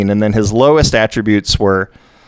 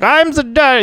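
high-pitched German duck voice; German-accented cartoon duck; slower than usual. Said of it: "he's got the German accent too".

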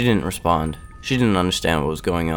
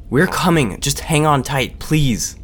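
urgent plea; 8 semitones higher, 3 dB louder, slower than usual. A narrator's reading. Urging her to hold on.

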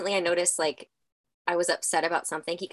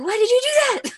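laughing quote; 5 dB louder than usual. Retold or imitated. Mom retells son's indignant question.

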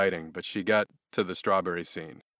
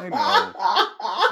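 laughing; 6 dB louder than usual. Retold or imitated. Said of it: "She's like".

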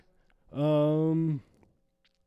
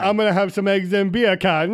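mock Jamaican accent; 4 semitones higher, 5 dB louder than usual. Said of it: "bacon in Jamaican".

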